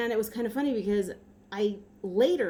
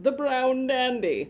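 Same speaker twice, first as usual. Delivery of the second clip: drawn-out, higher character announcement; comic character voice; 4 semitones higher, 4 dB louder, slower than usual. Acted. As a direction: play it as a silly talk-show character, stretched out. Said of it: "one of his characters from before".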